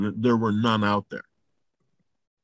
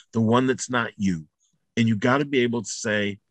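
ordinary delivery

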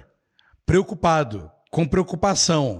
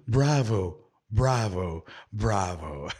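fading bravo; 8 semitones lower, 6 dB quieter, slower than usual. Acted. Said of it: "Por isso eu estou gritando".

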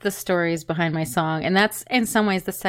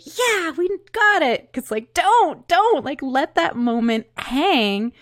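mock eager engineer; 7 semitones higher, slower than usual. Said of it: "waiting to say".

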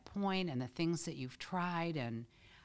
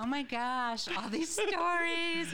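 high, delighted oh my gosh; delighted exclamation; 13 semitones higher, 5 dB louder than usual. Real, not acted. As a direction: gush with joy at the memories. Touched by memories, exclaiming.

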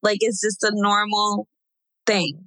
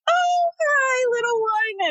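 tender greeting; slower than usual. Retold or imitated. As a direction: coo a first hello to the newborn. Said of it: "and I was like".

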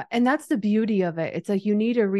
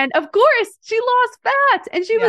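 much higher, excited and louder; 6 dB louder than usual. Real, not acted. Telling her cohost about a client's fat loss, high and excited. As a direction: let the excitement lift the voice up high.

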